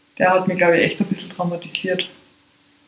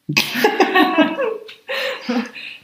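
high laugh; 12 semitones higher than usual. Real, not acted. Laughing at own spooky joke.

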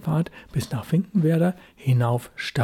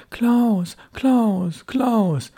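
mother calling her son, higher and carrying; 6 semitones higher, much slower than usual. A narrator's reading. Mother calls for her missing son, high calling voice. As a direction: call his name into the dusk, rising high each time. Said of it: "hörte er seine Mutter schon rufen".

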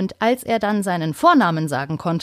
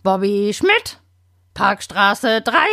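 slow, lightly croaked name and address; a budgie rasping out who it is; croaking parrot; slower than usual. Acted. Budgie answers grandma, slow light rasp. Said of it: "krächzt".

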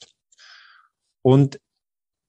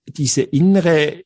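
ordinary delivery

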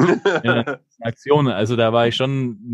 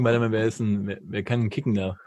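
thinking hmm; 4 semitones lower, 7 dB quieter than usual. Real, not acted. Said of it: "Jetzt lass mich überlegen".

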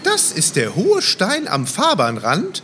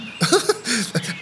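light amused chuckle; a quick puppet-show laugh; short laugh; 4 semitones higher, 4 dB quieter than usual. Acted. Kasper laughs at the name.

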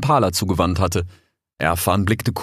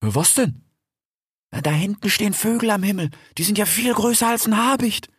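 higher, wondering voice; lightly voiced young wolf; 13 semitones higher than usual. A narrator's reading. Rix asking his fox friend about the birds, lightly voiced. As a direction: ask like a nervous newcomer.

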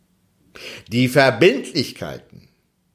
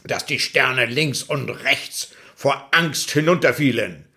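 ordinary delivery

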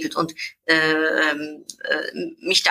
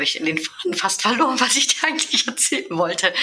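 laughing at herself; 5 semitones higher than usual. Real, not acted. Losing her thread, laughs.

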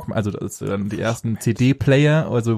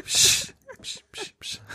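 mock shushing; 4 dB quieter, much slower than usual. Mock shushing the other host.

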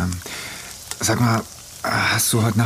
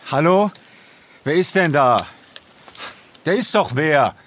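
raised, higher-pitched call; calling out warily; 5 semitones higher than usual. Acted. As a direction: call into the dark to an unknown someone. Calling out into the dark.

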